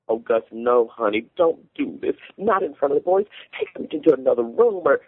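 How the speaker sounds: plead, voice climbing as you go. A narrator's reading. Mother begging husband not to shame child, pleading.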